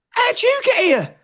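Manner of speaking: high, slow astonished question; can't believe you're here; amazed question. Retold or imitated. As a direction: friend amazed to meet him at the arena. Friend amazed to see him.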